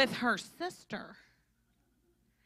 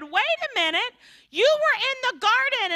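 much higher, louder accusation; accusing witness; 12 semitones higher, 7 dB louder than usual. Acted. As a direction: call him out as the one.

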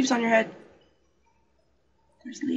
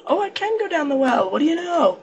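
pleased surprise; 6 semitones higher, 6 dB louder than usual. Real, not acted. Delighted at finding well.

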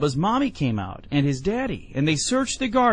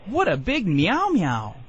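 put-on higher, slow, childlike wonder; delighted small child; 5 semitones higher, slower than usual. A narrator's reading. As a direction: marvel like a toddler, drawn out. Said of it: "He only laughed and said".

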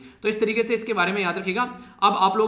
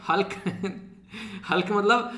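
amused laughing. Real, not acted. Teacher tickled by his own Hulk comparison, chuckling. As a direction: laugh between the words.

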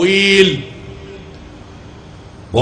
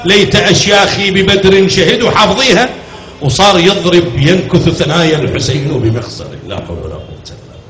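loud, raised lament preaching; full-force sermon recitation; 3 semitones higher, 3 dB louder than usual. Acted. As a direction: drive the mourning story to the crowd.

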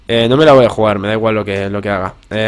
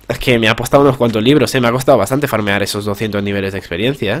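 ordinary delivery

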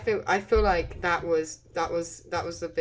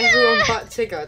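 scream into giggles; 8 dB louder than usual. Real, not acted. Teasing friend, shrieking exclamation.

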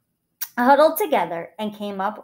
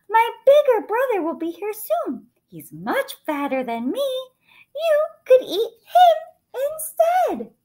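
tiny high-pitched pleading voice; high little goat bargaining for its life; scared little goat; 4 dB louder than usual. A narrator's reading. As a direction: talk your way out, small and high.

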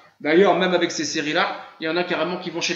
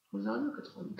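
under-breath repeat; 4 semitones higher, 16 dB quieter, much faster than usual. Real, not acted. Repeats the question to himself.

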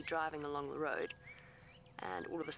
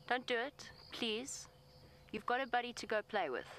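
higher, coaxing plea to a big animal; pleading with elephant; 6 semitones higher than usual. Real, not acted. Guide pleads with the bull elephant, higher coaxing voice. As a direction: gently talk the bull out of it.

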